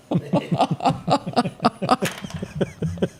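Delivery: high-pitched hearty laugh; high cackling laugh. Real, not acted. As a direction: burst out cackling. Laughs at Bobby Ewing joke.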